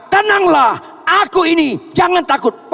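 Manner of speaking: high, loud call of tenanglah; loud, high voice of Jesus calling out; Jesus commanding. Acted. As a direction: ring out the command to be calm. Preacher voices Jesus commanding calm.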